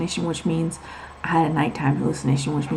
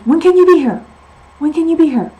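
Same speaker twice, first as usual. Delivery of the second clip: urgent demand; 10 semitones higher, 10 dB louder than usual. Retold or imitated. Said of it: "And she says".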